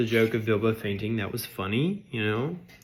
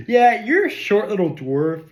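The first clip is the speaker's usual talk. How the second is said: higher, louder jeering elf; mocking elf; 8 semitones higher, 7 dB louder than usual. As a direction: sneer at the dwarf like a snooty elf. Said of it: "this elf going".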